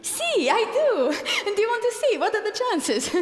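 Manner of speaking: high, laughing reveal. Acted. Figaro laughing as he shows Marcellina the birthmark. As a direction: laugh through it with giddy disbelief.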